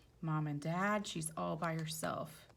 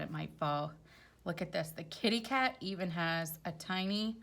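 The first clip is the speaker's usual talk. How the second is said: ordinary delivery, nothing marked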